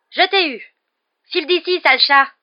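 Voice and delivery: high, loud triumphant then scolding yell; shouting. A narrator's reading. Ron grabbing pet, chasing cat off, shouting. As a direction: yell it at full force. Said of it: "ses cris se répercutaient en écho".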